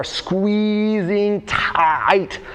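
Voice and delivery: "squeezing tight" held long on each word; drawn-out emphasis. Real, not acted. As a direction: stretch out SQUEEZING TIGHT slowly.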